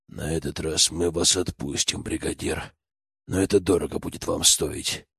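low, soft menacing hiss. A narrator's reading. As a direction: hiss a quiet threat. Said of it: "прошипел".

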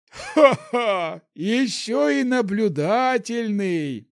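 put-on high, loud, slow delighted old man; laughing old man. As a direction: cackle with approval. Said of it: "рассмеялся старик".

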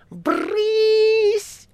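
very high sudden shriek; cat's bark. A narrator's reading. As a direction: snap it out like a cat. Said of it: "вдруг рявкнул кот". (резкий рык кота)

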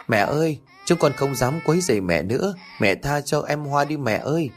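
put-on crying child. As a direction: beg mother through tears in a child's voice. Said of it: "tiếng khóc chói tai vang lên".

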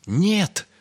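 high, loud wail of refusal; crying out. A narrator's reading. Little Ryum flees the rat's threat, crying out. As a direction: wail it in panic. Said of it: "заплакал Рюм".

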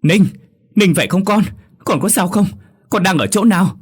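high, loud panicked cries to her son; panicked scream. A narrator's reading. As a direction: shriek for her son in terror. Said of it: "bà hoảng quá hét lên".

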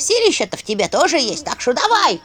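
put-on high, rattling old skull voice; cackling skull voice. A narrator's reading. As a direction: egg her on like a cackling skull. Skull urges her to fight.

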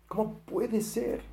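a hushed, drawn-out cry of doubt; bewildered plea. Acted. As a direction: let the question fall away in quiet disbelief. Abraham's doubting question voiced.

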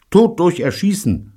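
high, loud burst; eager proposal. Acted. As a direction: pitch it high and keen. Betty eagerly proposes a death sentence.

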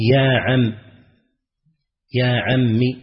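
"يا عمِّ" with the mim ending in a short i; kasra on mim. Shown as a demonstration. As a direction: say "يا عمي" with a clipped kasra on the mim. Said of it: "بكسر الميم". (بكسر الميم في "يا عمِّ")

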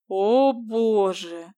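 very slow, stunned realisation; dawning horror, drawn out; shocked gasp. Acted. Husband realises the mistake.

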